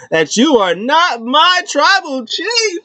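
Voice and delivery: high, slow, strained imitation; mock defiance. Manager refusing to acknowledge the new chief, high mock defiance. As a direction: draw it out high in dramatic refusal.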